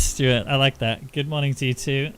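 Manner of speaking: bad Australian accent. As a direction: ham up an Aussie greeting. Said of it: "my Australian accent is like a mixture of Cockney".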